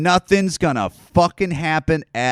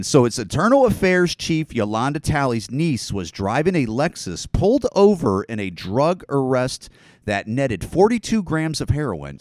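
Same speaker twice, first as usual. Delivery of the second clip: normal talking voice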